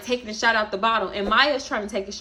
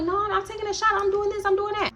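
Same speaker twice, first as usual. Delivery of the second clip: pitched-up sassy refusal; high sassy friend voice; 10 semitones higher than usual. Retold or imitated. Darnisha quoted.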